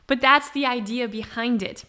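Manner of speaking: ordinary talk, nothing marked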